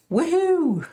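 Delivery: high whoop of joy; squealed high woohoo; excited cheer. Real, not acted. Cheering a guilty verdict.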